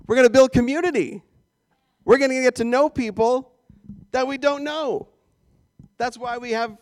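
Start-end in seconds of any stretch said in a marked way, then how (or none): 0.1-5.1 loud, high proclamation; emphatic exclamation. Acted. Preacher rallies congregation to build community, loud exclamation. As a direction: burst out loud after the hush. Exclaims after the hushed catch.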